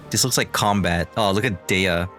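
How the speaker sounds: ordinary talk, nothing marked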